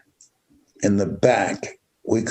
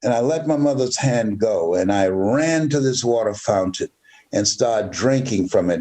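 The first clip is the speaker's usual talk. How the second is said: usual speaking voice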